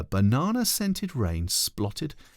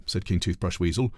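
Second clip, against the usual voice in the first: plain reading voice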